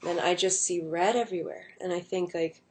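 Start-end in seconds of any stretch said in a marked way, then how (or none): none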